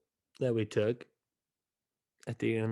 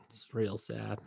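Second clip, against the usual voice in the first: sad whisper; 7 dB quieter than usual. Real, not acted. Mourning Packers loss, sighs first.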